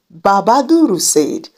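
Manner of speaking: ordinary delivery, nothing marked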